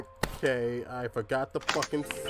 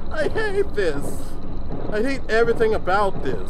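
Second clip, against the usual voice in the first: panicking gamer; 7 semitones higher, 7 dB louder than usual. Real, not acted. Tense, dreading.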